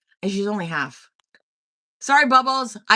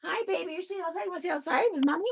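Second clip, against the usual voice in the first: singsong mommy voice, pitched up; 11 semitones higher, faster than usual. Real, not acted. Greeting her baby as mommy.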